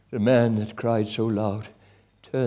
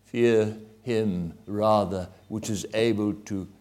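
scripture quoted with force; 3 semitones lower, 5 dB louder, slower than usual. Retold or imitated. Preacher quoting the Bible to a wounded attacker, loud then hushed and slow. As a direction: proclaim it, then let it fall to a slow hush. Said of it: "it says".

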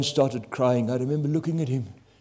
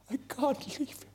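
breaking plea; 13 semitones higher, 9 dB quieter than usual. Retold or imitated. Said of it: "pulled him begging".

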